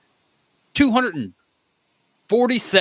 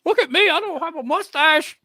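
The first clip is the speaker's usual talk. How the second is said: high, goofy mock voice of a friend; Shannon impression; 11 semitones higher than usual. Host mocking Shannon for the live chat, high goofy voice. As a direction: ham up a dopey Shannon, pitched way up. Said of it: "that was my Shannon impression".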